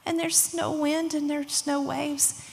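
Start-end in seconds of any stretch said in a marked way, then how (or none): none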